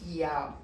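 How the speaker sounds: "Yau" repeated flatly as the error; mocking wrong Yau. Shown as a demonstration. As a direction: hold up "Yau" as the wrong form. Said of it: "horrível isso aqui". ("Yau" dito como erro)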